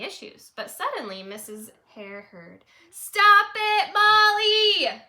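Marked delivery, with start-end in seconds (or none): 2.9-5.0 put-on high, very loud child scream; kid yelling. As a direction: scream it at a classmate. Children quarreling again.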